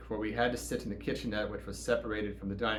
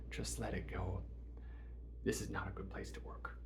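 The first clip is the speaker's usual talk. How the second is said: sly honeyed whisper; conspiratorial whisper; 4 semitones lower, 13 dB quieter than usual. Retold or imitated. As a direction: lean in and breathe it secretly. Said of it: "with a conspiratorial voice".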